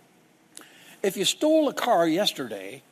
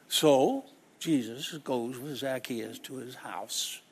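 usual speaking voice

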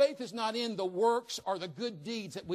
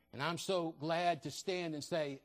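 normal talking voice

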